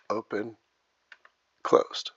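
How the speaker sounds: normal talking voice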